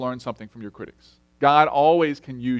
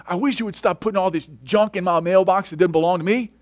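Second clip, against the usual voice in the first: a hint of a furious driver, higher pitched; mock angry driver; 5 semitones higher than usual. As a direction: imitate the driver's accusing complaint. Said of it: "with just the angriest, most violent tone".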